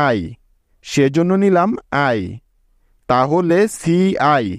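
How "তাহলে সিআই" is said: CI drawn out letter by letter; teacher spelling CI slowly. Shown as a demonstration. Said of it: "মিলে হলো সি".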